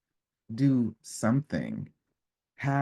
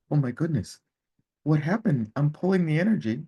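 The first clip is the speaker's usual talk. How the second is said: higher, fast flustered inner voice; panicking when the trick doesn't work; alarmed surprise; 7 semitones higher, 5 dB louder, faster than usual. Retold or imitated. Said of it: "I was like".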